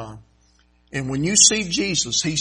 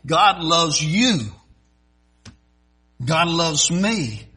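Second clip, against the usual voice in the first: YOU and ME drawn out slowly; slow, weighty preaching, leaning on YOU and ME; emphatic declaration; 2 semitones higher, 4 dB louder, much slower than usual. Real, not acted. Preacher drives home personal point.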